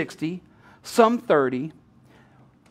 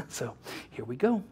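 ordinary talk

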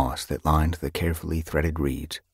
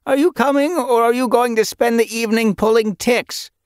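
calling out; 3 dB louder than usual. A narrator's reading. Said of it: "she called up to the Elder's Den".

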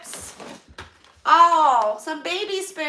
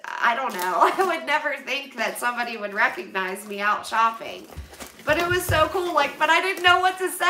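ordinary delivery

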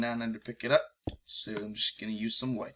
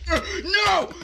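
high squeal of dread, loud; 10 dB louder than usual. Real, not acted. Gamer spotting danger again, high squeal of dread. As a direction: shriek it in real alarm.

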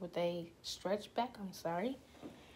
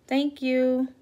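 bright thanks; 6 semitones higher, 13 dB louder than usual. Real, not acted.

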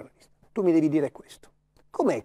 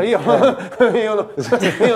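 higher-pitched imitation of a nervous little laugh; mock nervous giggle; 6 semitones higher, 3 dB louder than usual. Pundit mimicking the footballer's answer to colleagues, mock nervous giggle. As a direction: giggle through the words, mimicking someone nervous. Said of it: "la risatina nervosa".

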